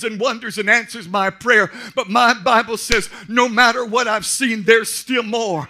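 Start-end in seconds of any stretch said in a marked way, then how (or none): 1.9-5.7 rising louder each there's still more; building sermon. Acted. Pastor to congregation, building to a shout. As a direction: climb to a shout.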